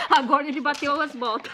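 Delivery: giggling through the words; laughing. Real, not acted. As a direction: laugh as you joke about him. Laughing at the figure.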